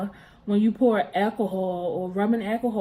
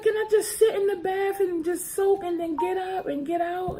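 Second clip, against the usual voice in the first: put-on child's voice pitched up high, begging; high pleading child voice; 10 semitones higher than usual. Little girl begging mom to let her soak, high and pleading. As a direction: beg in a small high voice.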